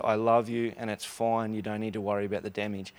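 tender, gentle forgiveness; husband softly reassures his tearful wife; reassuring. Retold or imitated. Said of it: "he said".

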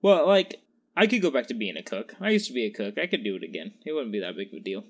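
ordinary delivery

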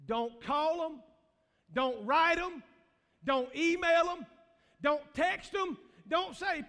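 a preacher laying down the law; preaching at full force. Acted. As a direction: pound each command out. Preaching commands to the church.

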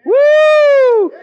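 high, loud, long whoop; excited whoop. Real, not acted. Preacher exclaiming to the congregation, high excited whoop. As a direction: let out a soaring "woo".

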